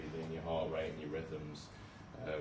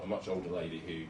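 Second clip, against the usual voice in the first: usual speaking voice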